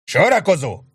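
high, loud rally call; officer bellowing to fall in; bellowed order. A narrator's reading. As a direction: yell the men into line. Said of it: "ordította Sharp".

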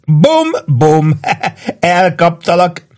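high, drawn-out pretend gunfight; mock-triumphant high calls; playful gloating. A narrator's reading. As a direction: crow like a drunk playing soldiers. Drunk man plays shooting.

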